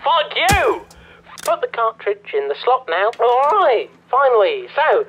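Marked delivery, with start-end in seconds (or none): 0.1-0.9 sudden acted flare of anger; angry outburst. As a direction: fling the curse out at a raised pitch.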